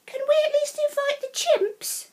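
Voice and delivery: high, small cub voices; pleading little cubs asking sweetly; childlike squeaky plea; lion cubs. A narrator's reading.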